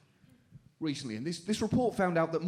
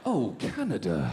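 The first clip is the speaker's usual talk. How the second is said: mock moan; 9 semitones lower than usual. Anthem parodied as orgasmic.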